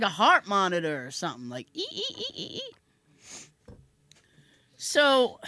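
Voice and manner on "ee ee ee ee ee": high, soft repeated squeaks; squeak imitation. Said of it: "So I'm hearing this squeak".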